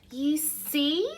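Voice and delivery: high-pitched little mouse; smug mouse. Acted. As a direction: prove your point as a mouse. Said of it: "said Mouse".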